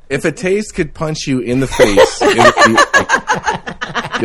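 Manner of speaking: breaking into high laughter; laughing. Real, not acted. Laughing at a taste that could punch you, real laughter. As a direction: laugh through the words, slowing down.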